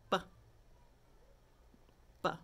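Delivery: "B" as a short "buh"; quick sound. Shown as a demonstration. Teacher asking long or fast, "B" as quick "buh". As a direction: say "B" as one brief burst. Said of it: "That's a fast one".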